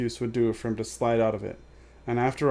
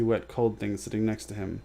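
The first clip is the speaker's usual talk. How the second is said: ordinary delivery, nothing marked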